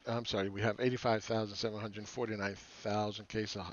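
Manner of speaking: ordinary talk, nothing marked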